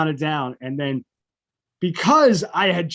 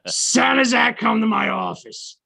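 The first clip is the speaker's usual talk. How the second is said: intercom summons; 5 semitones higher than usual. Retold or imitated. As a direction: boom it like a school loudspeaker. Loudspeaker calls student to office.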